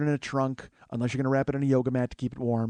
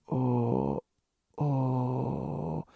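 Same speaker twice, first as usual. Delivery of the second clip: imitated moaning; 4 dB quieter than usual. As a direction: groan like a dazed man holding their head. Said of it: "he's got his head in his hands".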